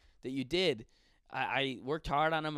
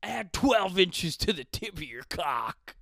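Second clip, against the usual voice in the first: slow, loud mock commercial announcer; ad pitch; 3 semitones higher, 6 dB louder, slower than usual. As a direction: proclaim it like an over-the-top advert. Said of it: "The ad".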